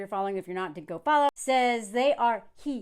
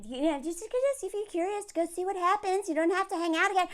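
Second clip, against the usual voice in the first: soft, higher coaxing voice; imitating reasonable person; 5 semitones higher, 7 dB quieter than usual. Someone reasoning with the dude, soft mock coaxing. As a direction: coax gently in a light, high voice. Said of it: "try to be reasonable".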